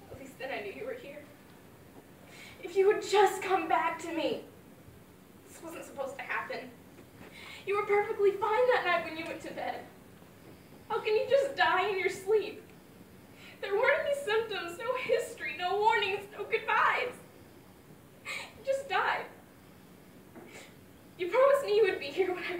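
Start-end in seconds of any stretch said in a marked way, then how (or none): 2.6-4.4 pleading grief. Acted. Grieving daughter pleads at grave. 7.7-19.2 rising anguish. Acted. Grieving daughter at father's grave.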